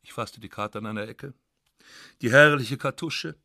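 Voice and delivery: ordinary delivery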